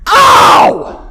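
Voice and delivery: high, loud yelp of pain; yelled ow. Retold or imitated. Husband on the hallway floor after hitting his knee, loud pained yelp. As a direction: howl it like the knee just hit. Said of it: "I'm going".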